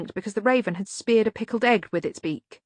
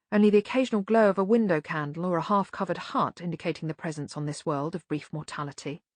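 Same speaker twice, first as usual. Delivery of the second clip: ordinary delivery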